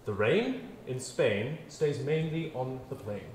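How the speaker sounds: "The rain in Spain stays mainly on the plain." spoken slowly, each word shaped; slow elocution demo. Shown as a demonstration. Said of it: "I want you to repeat this".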